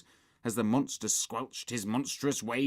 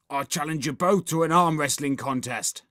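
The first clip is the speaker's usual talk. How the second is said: raised, loud werewolf challenge; howling werewolf; 5 semitones higher, 7 dB louder than usual. A narrator's reading. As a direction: throw down a challenge, lightly wolfish. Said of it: "howled the werewolf".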